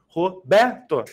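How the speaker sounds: "Roberto" in pieces with BER the strongest; Ro, BER, to, marking the second-to-last syllable; penultimate syllable stressed. Shown as a demonstration. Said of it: "na penúltima sílaba".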